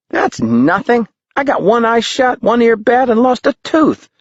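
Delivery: put-on high kid voice bragging; a boy proudly tallying his wounds; boastful boy. A narrator's reading.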